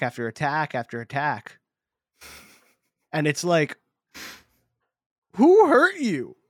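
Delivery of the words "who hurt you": high, loud, drawn-out wail; hammed-up wounded protest, stretched out; mock distress. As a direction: cry it out in exaggerated disbelief at the attacker. Mock plea to attacking Pokemon.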